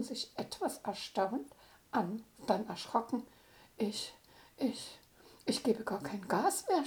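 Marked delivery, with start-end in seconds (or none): 3.8-6.8 halting, repeating the first word in a mild scare; stammering. A narrator's reading. Isa at the wheel, car speeding on its own, stammering. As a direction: stumble over the first words, a touch frightened. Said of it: "stotterte Isa".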